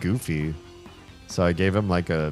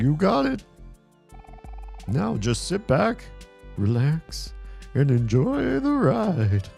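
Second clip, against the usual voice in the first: clown voice; 2 semitones higher than usual. Acted. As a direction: play a cheerful clown. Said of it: "a clown voice or something".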